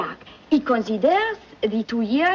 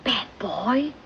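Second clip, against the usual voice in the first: put-on whispered aside in a French accent; 4 dB quieter than usual. French maid whispering to the butler about the boy. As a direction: whisper it conspiratorially.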